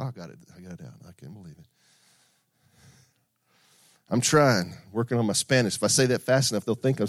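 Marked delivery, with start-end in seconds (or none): none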